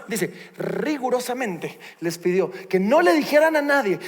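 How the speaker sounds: normal talking voice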